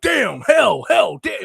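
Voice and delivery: a playful yelled cussing bit; mock shouted curses. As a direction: bark the curse words like a compilation. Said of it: "He's like".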